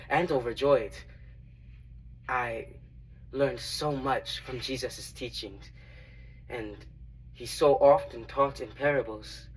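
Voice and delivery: measured dramatic monologue, long pauses; earnest storyteller, pausing between phrases; Matthew. Acted. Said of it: "Today's monologue is Matthew's testimony".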